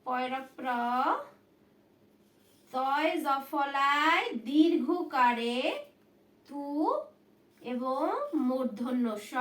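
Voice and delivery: put-on drawn-out spelling for children; slow spelling. Shown as a demonstration. Teacher spelling Pratyush for students, slowly. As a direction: slowly dictate তয় জ ফলায় দীর্ঘকারে. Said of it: "বানানটা দেখো".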